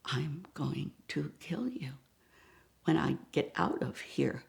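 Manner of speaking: soft, low whispered threat; whispered threat to friends; menacing whisper. Retold or imitated. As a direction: hiss it under your breath. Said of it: "I said to them".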